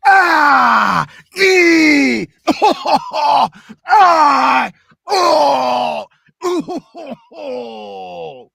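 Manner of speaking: very high, loud cries of pain on each vowel; pained screams on vowels. Shown as a demonstration. Voice actor demoing getting beaten up, shrieking vowels. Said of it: "every time I get hit, I'm saying my vowels".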